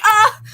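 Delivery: high, loud sobbing wail; a sudden teary cry of joy; retold happy sob cry. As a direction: let out an overwhelmed wail. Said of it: "I was like".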